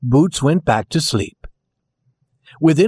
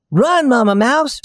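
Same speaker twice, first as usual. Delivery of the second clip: urgent mock shout; 10 semitones higher than usual. A narrator's reading. Doll urging the mama mouse to escape, high shout. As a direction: call out high to send them fleeing. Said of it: "Raggedy Ann shouted".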